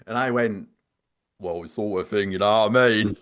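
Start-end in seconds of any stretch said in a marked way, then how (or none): 1.4-3.2 made-up character. Retold or imitated. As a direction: slip back into the prank character. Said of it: "that character that I made up".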